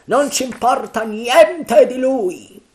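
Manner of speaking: high, loud, slow dismissal; Mary shouting the crew's courage back; defiant shout. Acted. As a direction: stamp each word down. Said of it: "gridò Mary".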